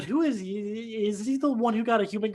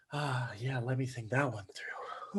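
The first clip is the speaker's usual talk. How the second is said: sarcastic whisper; 5 semitones lower, 11 dB quieter, slower than usual. Acted. Sarcastic aside.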